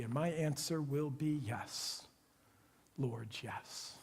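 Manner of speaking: slow, soft, low prayer; quiet surrender. Acted. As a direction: give in gently, word by word.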